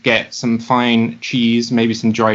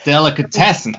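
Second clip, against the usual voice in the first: put-on [name] accent; 3 semitones higher than usual. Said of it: "Do the, uh, the [name] accent".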